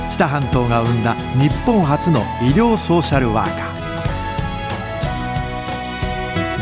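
usual speaking voice

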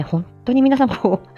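usual speaking voice

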